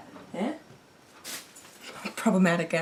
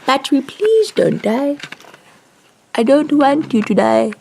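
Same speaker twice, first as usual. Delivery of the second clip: loud, higher, pleading cry to the battery; desperate begging; 5 semitones higher, 12 dB louder than usual. Real, not acted. Streamer begging her dying battery, loud and desperate. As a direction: beg the battery not to die, loudly. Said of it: "begging the battery loudly".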